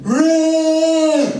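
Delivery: very high, loud "rah!" scream. Retold or imitated. Dave screaming so the band can sample it, high loud shriek. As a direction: let rip a piercing wordless shriek. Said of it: "sample this then".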